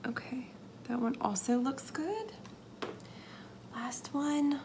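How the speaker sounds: tense careful whisper; concentrating whisper. Real, not acted. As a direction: whisper while holding steady. Nervous careful placing embeds.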